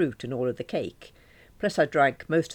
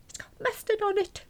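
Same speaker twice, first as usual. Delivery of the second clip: much higher squeaky complaint; squeaking. Acted. Alison tells David about the mustard, still squeaking high. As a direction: keep squeaking it out, still choking. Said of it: "I squeaked".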